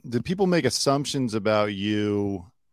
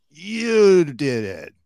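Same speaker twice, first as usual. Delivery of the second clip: slow, loud, pointed accusation, pitched up; accusing; 6 semitones higher, 8 dB louder, much slower than usual. Retold or imitated. Crowd blaming someone after Kobe died, slow loud accusation. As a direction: spit it out slowly like a blaming mob. Angry public blaming a victim.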